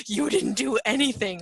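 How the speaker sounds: loud, exasperated mock outburst; angrier. You snap back at the pestering companion, loud exasperation. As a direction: burst out in acted frustration. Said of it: "makes you even more angry".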